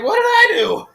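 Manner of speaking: very high-pitched mock innocence; mock bewildered protest. Retelling being yelled at, high mock bewildered protest. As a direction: squeak out a hammed-up, wounded question. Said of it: "she was yelling at me".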